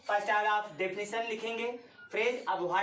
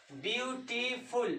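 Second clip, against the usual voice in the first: Beautiful slowly broken into view, टी, फुल; beautiful counted out slowly in three parts; three separate syllables; 4 dB quieter, slower than usual. Shown as a demonstration. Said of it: "three syllable वाले".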